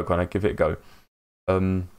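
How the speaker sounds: ordinary talk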